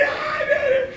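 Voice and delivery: high yelping cry of pain, laughing through it; mock pained cry. Preacher acting his childhood beating, high pained yelp. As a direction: squeal like a kid getting whipped.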